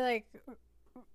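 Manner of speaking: normal talking voice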